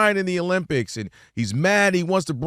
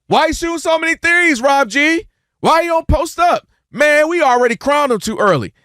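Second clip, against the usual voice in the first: high, louder mock heckle; whiny fake critic, pitched way up; 9 semitones higher, 3 dB louder than usual. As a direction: play a nagging fan second-guessing. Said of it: "What's the first thing they say?".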